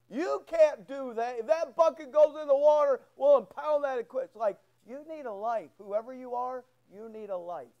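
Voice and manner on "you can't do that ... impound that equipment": mock official warning. Pastor mimics officer's threat.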